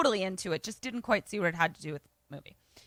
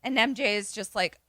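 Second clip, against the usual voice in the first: usual speaking voice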